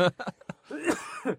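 rough choking cough. Real, not acted. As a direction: cough hard to clear the throat. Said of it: "I just swallowed a bug".

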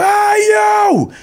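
high-pitched, drawn-out yell; yelling security guard. Retold or imitated. Mall security guard hailing someone walking by, yelling. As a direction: call out sharply, way up in pitch. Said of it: "He was yelling at me".

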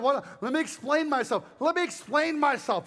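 high, pleading mock explanation; mock backpedalling. Preacher imagining Jesus calming a riot, mock pleading. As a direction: plead to be understood, hammed up.

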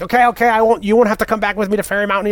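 high, quick pleading little leprechaun. Retold or imitated. Leprechaun King Brian pleads, voiced.

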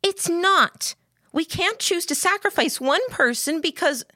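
high-pitched, distressed argument. A narrator's reading. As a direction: argue back urgently, pitch well up. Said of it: "Lillian raised her voice".

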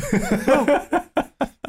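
high burst of laughter; laughing. Real, not acted. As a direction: crack up at your own joke. Laughs at own joke.

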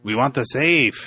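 slow, put-on Mexican accent; fake Mexican accent. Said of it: "his fake Mexican accent".

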